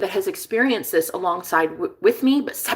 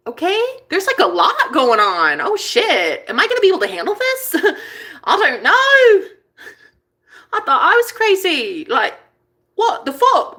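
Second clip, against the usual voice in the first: high, squealing mock panic; comically freaking out, pitch shooting up; overwhelmed outburst; 8 semitones higher, 4 dB louder than usual. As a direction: build to a loud, laughing wail. Acting out her overwhelmed reaction.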